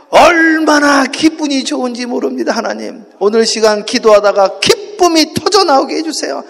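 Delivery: preacher's impassioned closing prayer; fervent public prayer. Real, not acted. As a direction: cry out petitions at full force, phrase by phrase. Leads closing prayer aloud.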